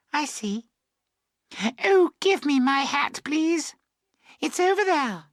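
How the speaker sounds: put-on very high girlish voice; girl's voice. A narrator's reading. Emily asking for her hat, high girlish voice.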